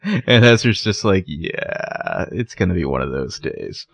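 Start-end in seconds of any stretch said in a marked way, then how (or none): 1.3-3.9 low, soft, resigned aside; mock resignation. Boyfriend facing an argument over breakfast, mock resignation. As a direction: imitate someone quietly bracing for a bad day. Retold weary reply in a quarrel.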